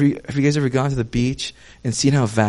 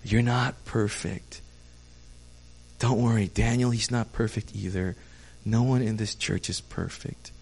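gentle comfort; 2 semitones lower, 5 dB quieter than usual. Retold or imitated. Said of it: "he wanted to just say".

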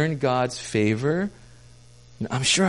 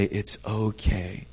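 gentle comfort; 2 semitones lower, 5 dB quieter than usual. Retold or imitated. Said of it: "he wanted to just say".

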